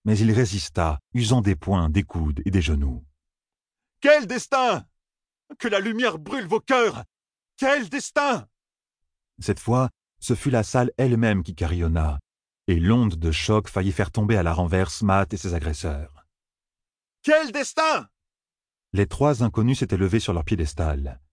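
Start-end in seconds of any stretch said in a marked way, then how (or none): 4.0-8.5 very high, frantic outcry; furious demand. A narrator's reading. Young man fighting off captors, furious demand. 17.2-18.1 piercingly high outcry; furious demand. A narrator's reading. Young man resisting attackers, furious demand.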